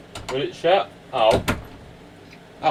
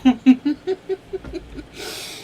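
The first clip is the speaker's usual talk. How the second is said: tender laugh; 12 semitones higher than usual. Real, not acted. Moved by dog story.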